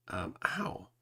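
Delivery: whispered wince of pain; a soft hurt ow as the splinter comes out; real little ow of pain. Real, not acted. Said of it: "That really hurt".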